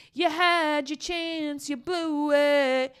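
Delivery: high, slow, stretched-out mocking chant; singsong taunt. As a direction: draw every word out high, teasing. Repeats it as drawn-out taunt.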